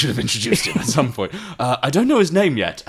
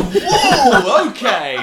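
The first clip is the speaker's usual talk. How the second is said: surprised; 12 semitones higher, 5 dB louder, much slower than usual. Acted. Reacting in character voice.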